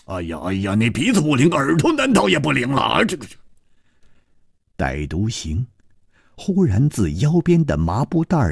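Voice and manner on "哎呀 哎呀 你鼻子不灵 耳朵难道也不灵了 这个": groans climbing into high-pitched complaint; agitated, frustrated grumble; exasperated impatience. A narrator's reading. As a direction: fret and scold, pitch rising. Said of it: "胡铁花跺着脚说".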